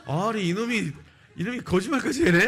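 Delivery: loud, high mock outrage; indignant telling-off at high volume; fuming boss. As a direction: act out an enraged manager. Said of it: "부장님이 더 열받았어".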